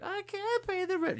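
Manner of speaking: high, far falsetto damsel wail; damsel in distress. Retold or imitated. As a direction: plead like a helpless melodrama heroine. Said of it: "Snidely whiplash".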